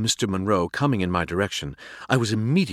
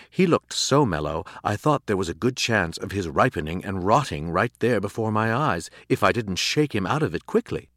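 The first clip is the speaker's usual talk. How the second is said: plain reading voice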